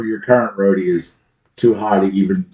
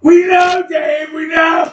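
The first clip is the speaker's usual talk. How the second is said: much higher, shouted taunt; mock shout; slower than usual. Cohost teasing Dave by shouting back, mock. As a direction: yell it back at him, teasing. Mocking the host's catchphrase.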